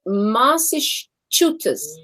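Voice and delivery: Massachusetts said slowly, syllable by syllable; slow labored Massachusetts; careful attempt. Shown as a demonstration. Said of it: "I can't pronounce Massachusetts".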